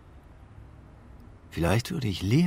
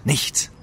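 nichts hit higher and louder; emphatic repeat of nichts; 6 semitones higher, 5 dB louder than usual. Acted. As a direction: punch out nichts bitterly. Bitter repetition of the word. (nichts mit Nachdruck wiederholt)

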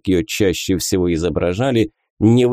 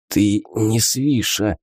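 hoarse; slower than usual. A narrator's reading. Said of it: "прохрипел Виллион".

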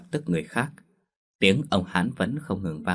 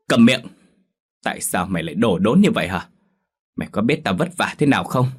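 much louder, glaring rage at the daughter; furious shout; 4 dB louder, faster than usual. Acted. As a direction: explode at the ungrateful child. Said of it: "và trừng mắt". (mẹ giận dữ quát con gái)

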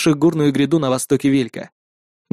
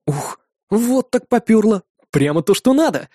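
excited; 6 semitones higher than usual. Acted. Alex's excited thought.

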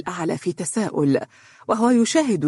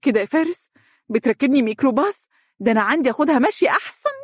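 high-pitched, put-out grumble; griping, voiced rather than whispered; grumbling complaint; 8 semitones higher, faster than usual. A narrator's reading. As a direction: complain to him in a higher voice. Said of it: "وهي تهمس بتذمر واضح".

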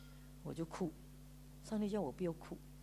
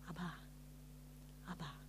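soft tender call; 5 semitones lower, 15 dB quieter than usual. Retold or imitated. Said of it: "很轻很轻的声音".

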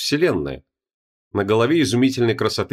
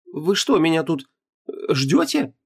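put-on higher, hesitant young woman; confused young woman; 7 semitones higher than usual. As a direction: ask it up high, unsure. Heroine confused by demon.

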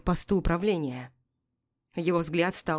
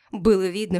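usual speaking voice

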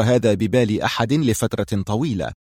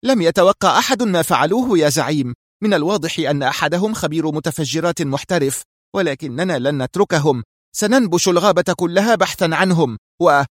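higher-pitched, defensive female voice; defensive justifying; 6 semitones higher than usual. A narrator's reading. Mira justifies the failure to her boss, defensive. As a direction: plead the case until cut off. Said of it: "قالت محاولة تبرير الموقف".

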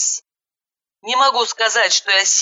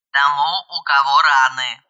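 disdainful; 5 semitones lower than usual. A narrator's reading. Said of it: "брезгливо поинтересовался голос".

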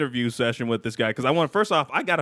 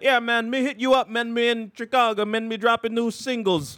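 higher mock Jamaican accent; 6 semitones higher than usual. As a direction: play a Jamaican rapper in a Jamaican accent. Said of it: "He was like".

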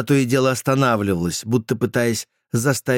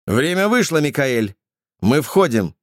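shouting; 5 semitones higher than usual. Acted. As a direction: shout the warning inside. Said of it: "прокричала Берит". (громкий окрик)

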